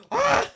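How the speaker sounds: high, loud shriek of surprise; short startled scream; sudden scream. Real, not acted. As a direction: scream as you get hit. Scream in game.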